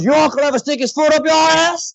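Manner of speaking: put-on high-pitched, hollered New Orleans accent; New Orleans tough guy. Tattoo guy needling his banter partner, New Orleans accent. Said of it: "that delivery of those li-, you hear that in Joe Baker".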